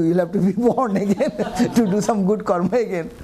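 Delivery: real chuckle breaking into the words; laughing. Real, not acted. Teacher telling listener about being born again, laughing. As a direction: laugh through the explanation. Amused teacher explaining rebirth.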